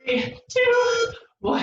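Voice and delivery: high, energetic count; calling countdown. Real, not acted. Instructor counting down for the class, high and bright. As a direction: call it out to push the class.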